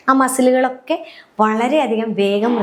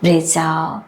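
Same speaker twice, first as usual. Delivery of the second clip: lower-pitched breathing coach; guided breathing cue; 5 semitones lower than usual. Shown as a demonstration. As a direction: ease the class out of the breath, low and long. Demonstrates the guided exhale cue.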